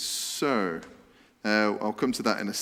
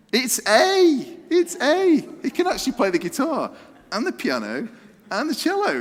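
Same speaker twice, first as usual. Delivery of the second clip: high, loud triumphant announcement; excited reveal; 12 semitones higher, 5 dB louder than usual. Real, not acted. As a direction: call out the answer like a game show host. Excited answer reveal to the audience.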